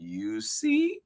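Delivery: very high little mouse voice; high-pitched, knowing little creature; smug mouse. Acted. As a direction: squeak it as proof. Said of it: "said Mouse".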